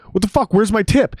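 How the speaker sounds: high, loud mock outrage; mock angry cabbie; indignant driver. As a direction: demand the tip like a cabbie. Driver demanding tip.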